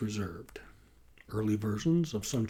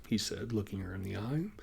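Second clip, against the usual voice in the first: usual speaking voice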